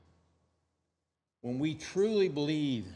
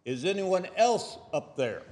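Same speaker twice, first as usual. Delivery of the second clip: loud yell, pitched higher, half-comic; 6 semitones higher, 7 dB louder than usual. Retold or imitated. Hanging man yells up asking for another voice, mock yell. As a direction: shout up hoping for someone else. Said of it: "He yells".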